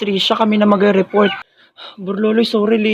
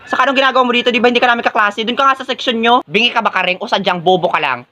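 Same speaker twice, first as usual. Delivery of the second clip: ordinary talk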